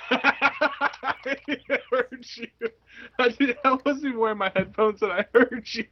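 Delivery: high voice, talking through real laughter; laughing. Real, not acted. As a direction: barely get it out while cracking up. Cracking up at co-host's retort.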